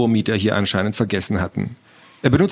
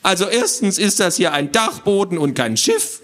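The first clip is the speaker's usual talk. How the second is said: high, lecturing little creature; squawky know-it-all; 8 semitones higher than usual. Acted. Little man explains gnomes to children, squawky lecturing. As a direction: lecture them in a fussy squawk. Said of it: "eine quäkende Stimme".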